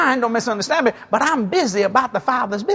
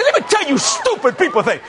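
high-pitched acted outburst; retold anger; 8 semitones higher than usual. Preacher telling off his plane critics, mad. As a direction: re-enact telling them off, pitched up. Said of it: "I got mad".

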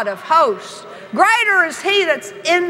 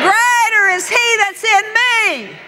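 preaching; 8 semitones higher, slower than usual. Acted. Preaching scripture loudly.

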